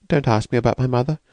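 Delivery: lightly voiced timid woman, anxious; frightened young woman. Escaped woman asks the narrator, lightly voiced and anxious.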